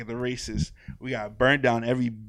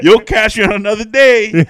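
loud, raised comic threat; mock threat; 10 semitones higher, 16 dB louder than usual. Owner warning the parrot, loud mock threat. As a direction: call it out as a joking warning.

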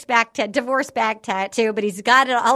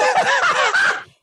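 high burst of laughter. Real, not acted. Laugh inside retold rant.

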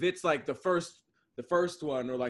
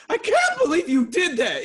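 acted high, loud exclamation; passionate outburst; 9 semitones higher, 6 dB louder than usual. Arguer in a passionate moment, high loud mock outburst. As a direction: burst out mid-argument, pitched way up. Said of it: "a passionate moment".

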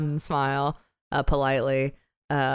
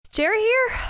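calling out. Acted. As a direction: call out for Jerry. Character calls into the portal.

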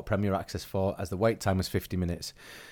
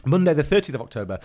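ordinary delivery

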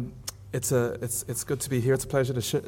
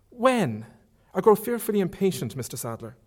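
put-on young woman's voice, higher, lightly protesting; reader's higher Marion voice; 7 semitones higher than usual. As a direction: lift the pitch for an eager young lady.

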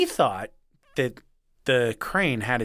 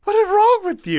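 very high, loud squeal of disbelief; shocked squeal; 7 dB louder than usual. Retold or imitated. Reacting on the phone to Cade bashing the goose, high and loud. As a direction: screech it at a friend who did something awful. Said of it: "and I was like".

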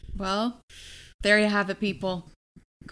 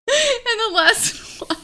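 laughing; 11 semitones higher, slower than usual. Real, not acted. Laughing mid-sentence.

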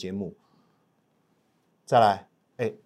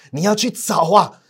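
ordinary delivery

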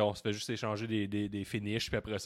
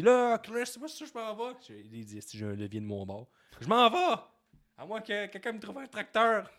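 mock Brock; 9 semitones higher, 4 dB louder than usual. Imagined Brock quote to Chris.

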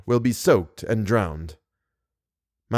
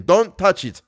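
higher-pitched called warning; urgent warning; 7 semitones higher, 4 dB louder than usual. A narrator's reading. Narrator warns Christine off the scorpion, urgent cry. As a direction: warn her off, pitched up.

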